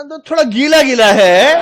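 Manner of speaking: high, loud, drawn-out mock verdict; hammed-up guessing. Bluffing trainee judges the lot by touch, hammed-up and drawn-out. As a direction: stretch it slowly for comic effect.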